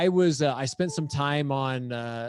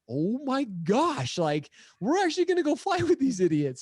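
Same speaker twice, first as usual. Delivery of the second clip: retold incredulous dread; 8 semitones higher than usual. Skydiver realising who will fly him, hammed-up incredulous dread. Acting his dread at crashed pilot.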